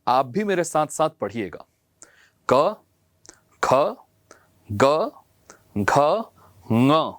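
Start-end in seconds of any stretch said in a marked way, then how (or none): 2.5-7.1 slow, separated क ख ग घ ङ; teacher demonstrates each consonant; reciting consonants. Shown as a demonstration. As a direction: clear consonant recital for pupils. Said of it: "मैं आपको उच्चारण करके बताता हूं".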